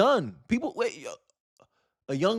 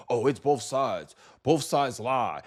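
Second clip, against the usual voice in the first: lower, slow, dismissive mock quote; mocking whine; 5 semitones lower, slower than usual. Vlogger mocking Democrat voters dodging his facts, in a slow, sneering mock voice. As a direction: drag it out like a lame excuse.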